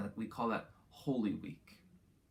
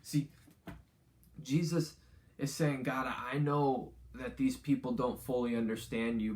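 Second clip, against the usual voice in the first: upbeat youth pastor; 3 semitones higher, 4 dB louder than usual. Acted. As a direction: plead it like a heartfelt prayer. Paraphrased prayer, preached with intensity.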